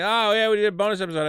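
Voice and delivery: cheerful plug of the new episode; upbeat self-promotion; retold excitement. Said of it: "'cause I was excited".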